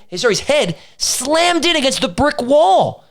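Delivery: ordinary talk, nothing marked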